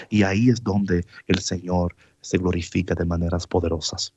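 ordinary talk

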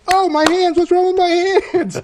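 very high, loud alarmed squeal; hammed-up freak-out, shrieking in retelling; mock panic. Acted alarm at baby hands.

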